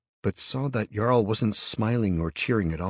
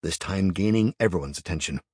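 usual speaking voice

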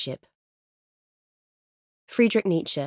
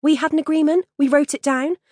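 indignant; 9 semitones higher, 4 dB louder than usual. Retold or imitated. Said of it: "this Lisa character goes".

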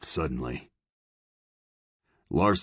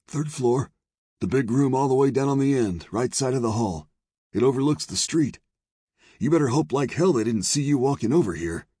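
high, fast, wound-up character voice; dark, anxious saloon owner; 9 semitones higher, 4 dB louder, faster than usual. Acted. Saloon owner warns the lawmen, high and urgent. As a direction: give rapid directions in a high voice.

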